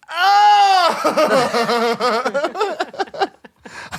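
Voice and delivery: high, squealing laughter close to crying; long uncontrolled laughing fit; helpless laughter. Real, not acted. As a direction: lose it completely, laughing to tears.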